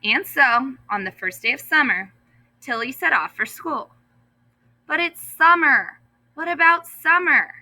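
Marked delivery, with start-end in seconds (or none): 4.9-7.5 high, louder call after his sister; a brother calling after her. A narrator's reading. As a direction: call out across the yard. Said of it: "her brother called".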